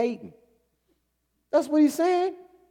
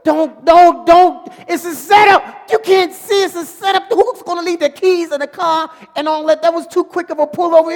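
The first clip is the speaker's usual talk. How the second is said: much higher, much louder frantic yelling; 9 semitones higher, 13 dB louder than usual. Retold or imitated. Viewer yelling at the TV not to take the bait, frantic shout. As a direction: shout a warning the driver can't hear. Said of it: "So now I'm yelling at the T V".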